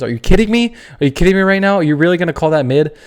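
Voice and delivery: loud, high disbelief; incredulous outburst. Real, not acted. To viewers who call Goff mid, an indignant disbelieving shout.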